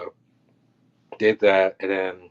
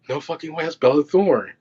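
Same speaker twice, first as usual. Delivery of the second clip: retold disbelief; 7 semitones higher, 3 dB louder than usual. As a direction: relive the stunned realization, pitched up. Retold shock at recognizing a celebrity.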